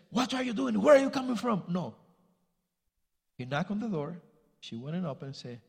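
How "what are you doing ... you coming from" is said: a hammed-up scolding mother, higher and louder; mock scolding. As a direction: play a mother scolding her late son. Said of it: "She never said".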